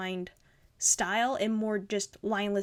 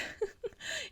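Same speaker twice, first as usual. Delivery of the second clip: delighted squeal laugh; 12 semitones higher, 10 dB quieter than usual. Real, not acted. Delighted at the cute drawing.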